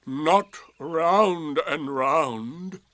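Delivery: loud, very slow correction; pointedly superior. Acted. Donkey correcting the boastful youngster, slow and loud. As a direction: drag out each word pointedly. Eeyore correcting proud Roo.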